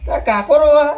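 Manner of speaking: high, loud disbelief; astonished question. Acted. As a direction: blurt it back in amazement. Hears that the uncle is crying.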